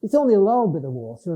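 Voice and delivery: "little" said as Cumbrian "lal"; quick Lake District lilt, "little" becoming "lal"; Cumbrian dialect. Shown as a demonstration. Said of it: "I'd speak like that when I'm with them".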